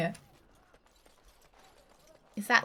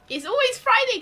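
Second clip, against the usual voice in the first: excited; 14 semitones higher, 6 dB louder than usual. Real, not acted. High loud insistence.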